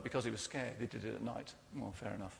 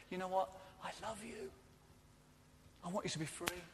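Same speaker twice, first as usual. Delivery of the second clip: much softer, gentle loving voice; God telling workplace people He loves them, softly and tenderly; God's tender words; 3 semitones higher, 10 dB quieter, faster than usual. Acted. God speaks to common people.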